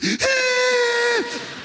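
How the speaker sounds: very high, sustained wheezing squeal; kettle whistle. Retold or imitated. Winded player gasping for air, kettle-like squeal. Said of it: "he sounded like a kettle".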